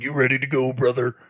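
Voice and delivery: hint of a Hulk-style wrestling promo; wrestler voice. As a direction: growl it like a pro wrestler. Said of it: "what I call the wrestler voice".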